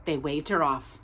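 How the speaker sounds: ordinary delivery, nothing marked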